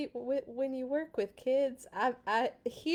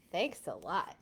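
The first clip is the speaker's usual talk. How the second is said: plain reading voice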